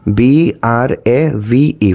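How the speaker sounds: slow spelling, each letter drawn out; measured, separated letters. Shown as a demonstration. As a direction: count out the letters one at a time. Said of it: "letter count করি".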